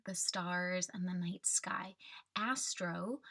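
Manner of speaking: usual speaking voice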